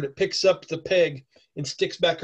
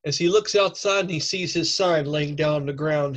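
ordinary delivery

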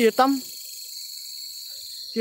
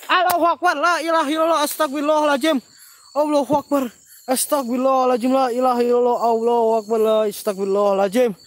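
loud, high invocations, pitch gradually settling; frightened praying; 7 semitones higher, 9 dB louder than usual. Real, not acted. Host startled again, praying loudly in alarm. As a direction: cry out prayers in sudden fright. Sudden fright, crying out prayers.

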